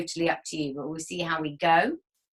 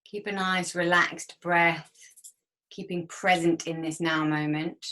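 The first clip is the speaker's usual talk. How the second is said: guided meditation. Acted. Leads a guided breathing exercise.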